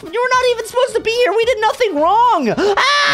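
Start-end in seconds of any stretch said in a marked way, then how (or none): 2.6-3.1 sudden high yelp of pain; startled yelp. Real, not acted. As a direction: yelp as you hit the lava. Ran into the lava, screams.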